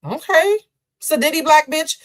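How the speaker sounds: high, loud sneer; contemptuous look. Retold or imitated. Seatmate dismissing him, high loud sneer. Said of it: "She looks at me like".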